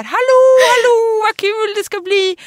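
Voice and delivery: imitating excited relatives, high and loud; gleeful greeting. Relatives arriving for the trip, calling out gleefully. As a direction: sing out a drawn-out, high hello.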